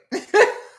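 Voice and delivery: short very high squeak of laughter; squeaky laugh. Real, not acted. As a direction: let out a tiny high giggle. Laughs at chat.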